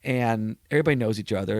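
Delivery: usual speaking voice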